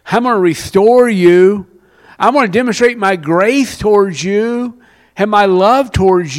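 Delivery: building to loud, high sermon crescendo; impassioned preaching. Acted. Preacher promising God's forgiveness to the congregation, impassioned and loud. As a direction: preach it out at full force, voice climbing.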